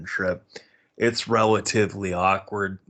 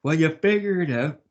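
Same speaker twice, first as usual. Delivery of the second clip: higher, louder snippy mimic of her parting shot; mocking her; 10 semitones higher, 7 dB louder than usual. Mimicking the girl slamming the car door, higher mock snippy voice. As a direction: parody her as she storms out. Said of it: "she says".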